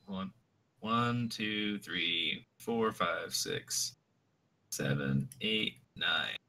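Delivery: quiet counting. Real, not acted. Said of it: "counting dice quietly".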